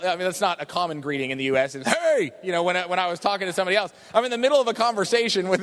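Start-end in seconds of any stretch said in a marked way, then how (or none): 1.9-2.3 higher mock shout of hey; sharp mock yell to get attention; imitated yell. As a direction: call out hey like a rude lady. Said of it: "not often people yell".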